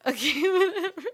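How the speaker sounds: laughing it off, loud and high; laughing through the words. Real, not acted. Wife laughs off his cat rant, laughing loudly.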